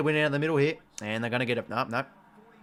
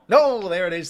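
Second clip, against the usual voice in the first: excited; 5 semitones higher, 9 dB louder than usual. Real, not acted. Player angrily points on screen.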